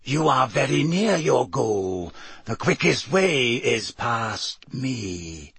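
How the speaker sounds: slow, measured creature voice, husky and drawn out; deep, hoarse sphinx. A narrator's reading. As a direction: intone it slowly as a hoarse riddling sphinx. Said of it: "she spoke in a deep, hoarse voice".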